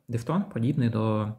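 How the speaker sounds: ordinary talk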